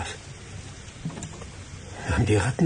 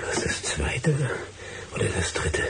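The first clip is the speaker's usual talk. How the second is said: whispered wondering; 4 dB quieter than usual. Acted. Man searching for the right knothole, whispering. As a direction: whisper the question to yourself. Searching floor, muttering to himself.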